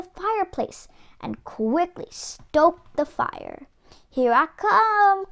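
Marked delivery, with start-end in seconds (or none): 4.1-5.3 higher, louder slow gleeful call; wolf cries out. Acted. Wolf on the roof calling to the pig, loud and gleeful. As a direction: crow it down the chimney. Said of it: "cried the wolf".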